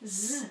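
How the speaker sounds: voiced, held z; letter sound. Shown as a demonstration. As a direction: sound out z as zzz. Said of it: "the S could make the".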